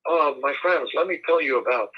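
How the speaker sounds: lightly put-on German accent; attempted German accent. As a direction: have a feeble go at a German accent. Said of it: "I can't do my German accent".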